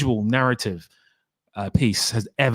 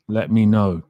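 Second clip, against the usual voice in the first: usual speaking voice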